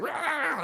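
imitated cranky dog bark; mock dog bark. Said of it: "she goes like".

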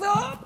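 high, soft strangled groan; imitated attempt to call out while being choked; choked cry. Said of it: "it was choking me".

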